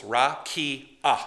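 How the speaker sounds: rakia split into three syllables, ra-ki-a. Shown as a demonstration. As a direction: count out rakia, ra-ki-a. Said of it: "Three syllables".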